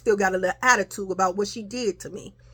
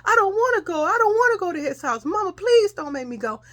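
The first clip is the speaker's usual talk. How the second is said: put-on high, wailing child's refusal; imitated crying; 11 semitones higher than usual. Child begging mama not to make her go, tearful wailing. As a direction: plead in a whiny mock cry, pitch way up. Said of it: "I just kept on crying".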